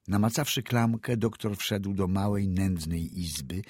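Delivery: plain reading voice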